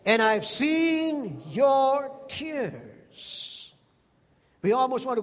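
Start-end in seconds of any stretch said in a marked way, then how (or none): 0.1-3.7 God speaking gently. Acted. Preacher voicing God to Hezekiah, slow and solemn. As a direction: say it like God consoling a dying man. God's words to Hezekiah, voiced.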